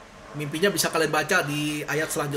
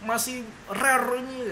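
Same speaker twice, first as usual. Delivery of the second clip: picky king; 7 semitones higher, slower than usual. Retold or imitated. Imagined picky king complaining.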